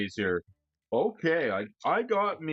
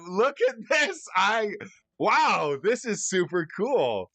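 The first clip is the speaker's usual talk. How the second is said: can barely contain the delight; excited; 7 semitones higher than usual. Real, not acted. Showing the bobblehead to viewers.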